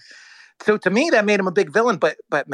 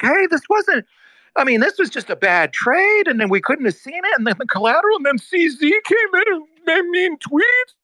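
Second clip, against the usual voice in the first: mock defense pitch; 12 semitones higher than usual. Imitating Sam's friends confusing the jury, high and mocking.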